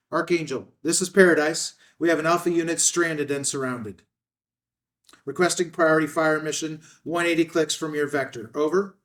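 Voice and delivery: put-on kid's pilot radio call, loud and high; boy playing at military radio, calling out. Young Jimmy calls in a fire mission, loud radio voice.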